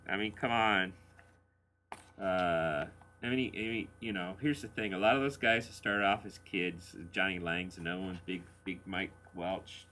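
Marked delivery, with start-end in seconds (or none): none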